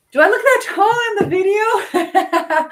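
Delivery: high, loud question breaking into a laugh; amused disbelief. Real, not acted. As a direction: ask it loudly, high-pitched, laughing. Amused reaction to comments on height.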